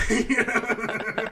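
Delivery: high laugh through the words; laughing while talking. Real, not acted. Laughing at the imagined scene.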